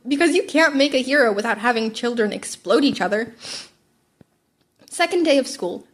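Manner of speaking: ordinary delivery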